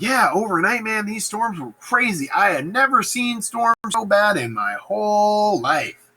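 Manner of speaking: hammed-up awe at the storms; mock amazed. Lennox telling Macbeth about the storms, hammed-up awe. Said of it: "so he's like".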